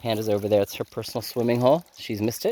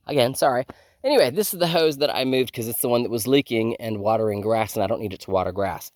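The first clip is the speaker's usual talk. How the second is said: ordinary talk, nothing marked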